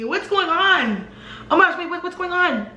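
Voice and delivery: high-pitched imitation of a flustered mother; mock alarmed mom. Mom waking up to find the commotion, high and flustered.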